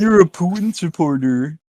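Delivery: mock accusation.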